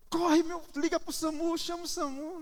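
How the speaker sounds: high, hammed-up panicked pleading; desperate mother. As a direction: imitate a mum frantically calling for an ambulance. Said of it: "a mãe se desespera". (imitação de mãe desesperada)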